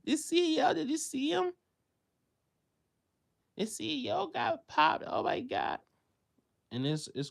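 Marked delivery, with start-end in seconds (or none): 0.0-5.8 high, excited mock chatter about the news; mocking gossip voice. Mocking people who laugh at the news, high and giddy. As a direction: ham up the shocked, giddy gossip. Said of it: "y'all laughing and joking".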